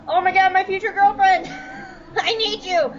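high-pitched hammed-up lovesick wail; Rex. Rex watching his future girlfriend's tank explode, mock desperate pleading. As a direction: plead melodramatically through a laugh. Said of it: "he's just going".

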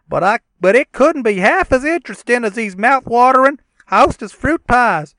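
high, loud dim-witted yokel; silly cousin voice. Acted. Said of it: "we can do a dramatic reading".

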